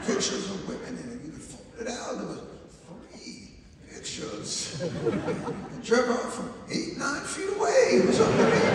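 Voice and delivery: hammed-up old-timer, high and breathy laughing; mock geezer remembering the good old days; an old-timer's nostalgic rant, played for laughs; old man reminiscing.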